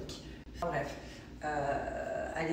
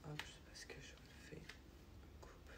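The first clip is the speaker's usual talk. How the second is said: whispering; 20 dB quieter than usual. Real, not acted. Whispering while baby sleeps.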